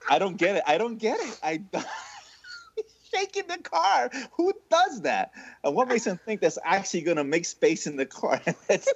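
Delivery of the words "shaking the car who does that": high, laughing, incredulous; laughing disbelief. Real, not acted. To his co-hosts about the friend, laughing. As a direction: marvel at it through laughter.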